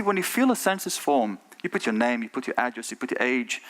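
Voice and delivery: usual speaking voice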